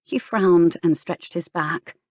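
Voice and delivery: ordinary delivery, nothing marked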